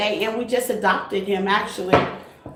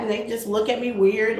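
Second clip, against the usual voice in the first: usual speaking voice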